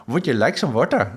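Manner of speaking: mock Indian English accent, "water" with the tongue curled back. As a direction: imitate an Indian speaker offering water. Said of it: "and they have this".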